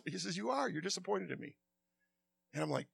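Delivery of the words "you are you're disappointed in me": soft, hushed voice of God; a tender, near-whispered rebuke; gentle God's voice. Retold or imitated. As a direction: quietly tell him the truth he hides. God gently exposing his heart.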